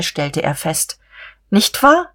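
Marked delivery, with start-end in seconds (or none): 1.5-2.1 high, lilting tag question; smiling. A narrator's reading. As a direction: smile through a high-pitched question. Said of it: "sagte sie mit einem Lächeln". (lächelnd, mit hoher Stimme)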